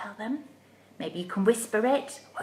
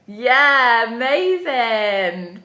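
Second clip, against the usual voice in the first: ordinary talk, nothing marked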